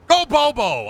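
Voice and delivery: high-pitched rallying yell; playful yelling. Pretend. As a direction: cheer it out like a chant. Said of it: "I'm just yelling".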